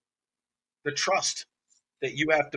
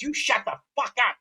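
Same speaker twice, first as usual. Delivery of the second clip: higher-pitched mock snap; retold angry outburst; 6 semitones higher, 4 dB louder than usual. Client turning on her boyfriend, mock angry. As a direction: imitate her telling him off. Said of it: "And she goes".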